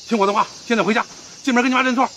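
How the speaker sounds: stern order. Acted. Man ordering a runaway boy back to his mother, stern. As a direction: order a wayward child about.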